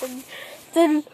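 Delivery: ordinary talk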